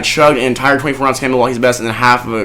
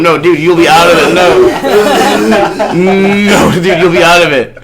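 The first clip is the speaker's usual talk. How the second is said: laughing; 5 semitones higher, 4 dB louder, much slower than usual. Real, not acted. Laughing insistence to friend.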